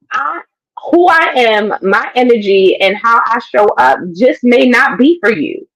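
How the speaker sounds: normal talking voice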